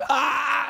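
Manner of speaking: high intake into a roar; tiger about to roar. Acted. Storyteller as tiger about to roar, high cry. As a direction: build up as if to roar. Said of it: "just about to roar".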